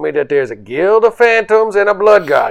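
tough talk. Acted. Character threatens guards.

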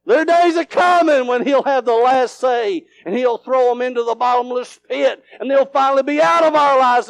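high, rousing proclamation; full-force preaching. Real, not acted. Preacher promising the devils' days are numbered, full-force preaching. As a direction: ring it out to the congregation.

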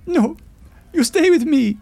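loud, very high, anguished plea; high-pitched begging cry; child's wail. A narrator's reading. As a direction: wail desperately as a little granddaughter. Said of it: "she wailed".

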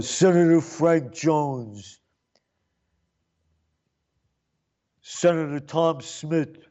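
a hint of a slow formal announcer, lightly imitated; drawn-out mock announcement of each senator; doorman announcing. Clown announcing senators at door.

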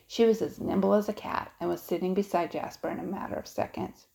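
usual speaking voice

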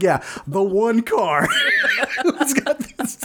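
slow sarcastic line broken by laughter; mock it while cracking up; laughing sarcasm. Mocking the clerk's note.

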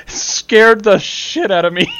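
high, loud, slow through laughter; laughing admission. Real, not acted. Player admitting the fright to friends, laughing. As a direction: squeeze it out while laughing.

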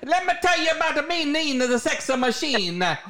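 hammy Italian mobster voice; hint of a New York Italian accent. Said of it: "That's my Italian accent".

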